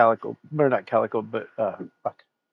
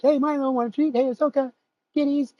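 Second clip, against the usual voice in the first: high sing-song treat call; bright pet-owner call to kitties; calling the cats; 11 semitones higher than usual. Retold or imitated. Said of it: "'Cause we're like".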